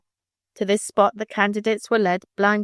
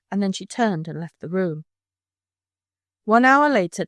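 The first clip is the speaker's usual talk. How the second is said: ordinary delivery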